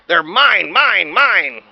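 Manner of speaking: loud greedy claim of mine; greedy dinosaur. Acted. Dinosaur grabbing blocks, loud greedy claim. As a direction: shout it like a spoiled kid.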